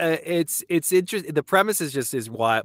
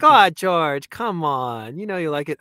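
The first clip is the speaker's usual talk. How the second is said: higher, louder teasing coax; 4 semitones higher, 6 dB louder than usual. Retold or imitated. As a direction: coax him playfully. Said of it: "I'll be like".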